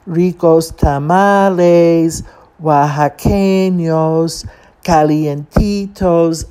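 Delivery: slow, drawn-out tamale vendor chant; complaining tape tone. Retold or imitated. Tape selling tamales from a cart, complaining drawn-out call. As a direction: imitate a recorded hawker, almost whining. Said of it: "complaining tone".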